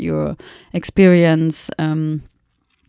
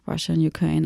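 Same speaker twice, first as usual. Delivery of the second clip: ordinary talk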